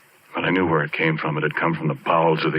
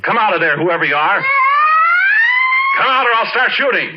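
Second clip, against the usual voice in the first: high, loud shouted threat; frightened yell; 6 dB louder than usual. Retold or imitated. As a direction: yell it at something hidden in the pipes. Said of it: "and I yelled".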